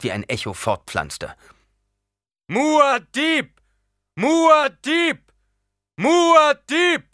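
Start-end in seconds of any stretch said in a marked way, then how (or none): 2.5-7.0 high ringing war cry, full force; battle yell. A narrator's reading. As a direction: hurl the name across the cave. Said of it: "Er stieß einen Kampfschrei aus".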